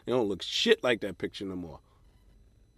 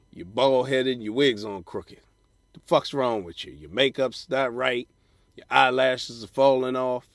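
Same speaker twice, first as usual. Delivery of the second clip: ordinary talk, nothing marked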